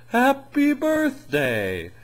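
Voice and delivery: very high, stretched-out cartoon snowman cheer; goofy Frosty. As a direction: squeal it like a newborn snowman. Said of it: "and he's like".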